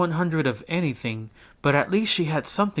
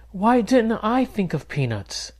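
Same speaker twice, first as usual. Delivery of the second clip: put-on envious girl; 9 semitones higher, 3 dB louder than usual. A narrator's reading. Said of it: "Jessica wondered".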